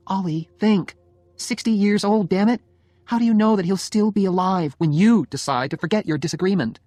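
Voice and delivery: wife arguing hard with her stubborn husband; exasperated Jenny. Acted. Jenny argues with Oliver in story.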